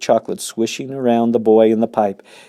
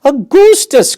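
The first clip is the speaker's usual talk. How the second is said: very high, loud call of the name; a mother crying out to her son; 11 dB louder, slower than usual. Acted. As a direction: shriek his name. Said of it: "cried Missus Gloop".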